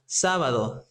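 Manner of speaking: sábado said as SÁ-ba-do; stress on first syllable. Shown as a demonstration. Teacher recaps the exception for learners, sábado stressed on SÁ. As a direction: put the weight on the SÁ of sábado. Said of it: "We need to respect the accent point".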